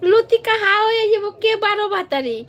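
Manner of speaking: high, loud and excited; excited teasing. Real, not acted. Teasing a viewer about Latika, excited and loud. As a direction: rattle it off with glee.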